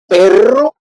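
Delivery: perro with a trilled double R; rolled Spanish perro. Shown as a demonstration. Teacher demonstrating a trilled perro. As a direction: roll the R hard in perro. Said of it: "So for example".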